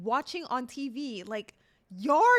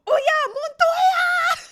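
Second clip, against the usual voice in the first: high, loud, slow laughing shout; excited shout; 10 dB louder, much slower than usual. Real, not acted. Streamer remembering the boyfriend's name, a high laughing shout. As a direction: shout the name as it comes back. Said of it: "remembering the name".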